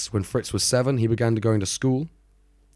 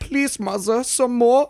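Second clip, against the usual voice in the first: Oliver Twist plea; 13 semitones higher, slower than usual. Retold or imitated. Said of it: "where they're just like".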